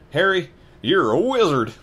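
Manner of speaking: loud, high proud declaration; booming reveal. Acted. Hagrid telling Harry what he is, booming. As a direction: announce it grandly.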